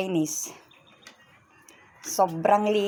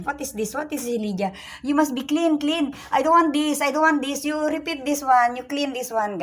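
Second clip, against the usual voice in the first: strict boss; 7 semitones higher than usual. Retold or imitated. A hint of the maid's demanding employer scolding her over cleaning. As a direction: bark out the cleaning orders like a fussy madam. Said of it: "Ang ganun ang style niya".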